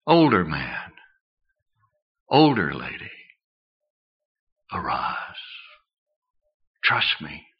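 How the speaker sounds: soft, low appeal with long pauses; hushed, intimate pleading; gentle divine call. Acted. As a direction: call each listener quietly, one by one. Imagined divine call to each listener.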